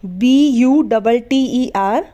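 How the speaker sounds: slow letter-by-letter spelling. Shown as a demonstration. Teacher spells butter for students letter by letter. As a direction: spell it out carefully. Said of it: "spells the word for students".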